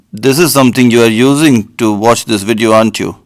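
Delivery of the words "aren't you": ordinary talk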